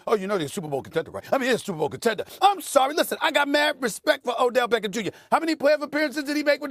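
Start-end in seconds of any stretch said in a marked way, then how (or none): 0.1-2.2 quick, softer mocking singsong; mocking Max. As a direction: sneer at the hype, lightly. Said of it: "People like you, Max".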